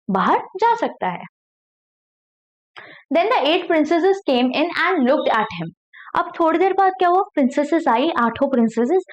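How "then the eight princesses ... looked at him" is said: slow, careful read-aloud of a story. Acted. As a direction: read each word slowly for learners. Reading the English story aloud. (धीमी कहानी पढ़ाई)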